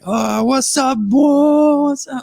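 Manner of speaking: high, slurred, hammed-up French party voice; drunk French accent. Host imitates himself drunk with French friends, high slurred French accent. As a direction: slur it like a tipsy Frenchman greeting friends. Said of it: "I sound a bit more French, and I kinda slurp my words".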